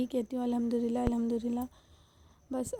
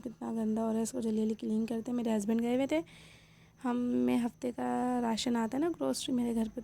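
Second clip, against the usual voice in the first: ordinary talk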